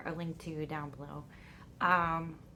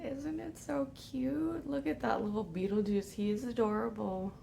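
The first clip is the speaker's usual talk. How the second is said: cooing; 3 semitones higher than usual. Real, not acted. Cooing over cute bag.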